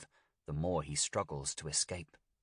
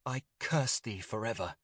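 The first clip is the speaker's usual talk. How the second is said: raised, anguished curse on "curse"; exclaiming a curse; 5 semitones higher, 3 dB louder than usual. A narrator's reading. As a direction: hurl the curse at her, louder.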